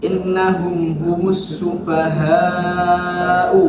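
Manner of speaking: sufaha'u with the vowel before the hamzah held long; long madd. Shown as a demonstration. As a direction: stretch out sufaha'u very slowly. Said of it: "Itu ada bacaan apa itu?".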